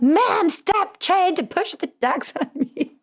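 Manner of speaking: very high, slow, hammed-up kid outburst; imitated yelling. Daughter yelling at mom about the Ducks, exaggerated mock outburst. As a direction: mimic an exasperated child, stretched out and squeaky-high. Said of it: "I just got yelled at again".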